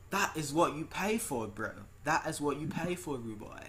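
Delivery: imitation of a UK dude's voice; Nick. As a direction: put on a friend's British way of talking. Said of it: "I'm gonna play Nick's voice".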